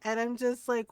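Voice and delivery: ordinary talk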